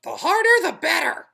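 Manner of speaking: tiny, high, loud tempting voice; pigtail voice. A narrator's reading. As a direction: lure him like a little devil. Pigtail insists.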